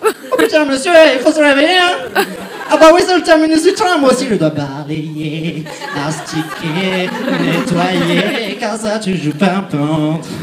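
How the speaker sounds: put-on playful Creole accent with laughter; a hammed-up Creole cleaning lady. Cleaning crew waking the sleeper, in Creole accent. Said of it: "je fais l'accent créole".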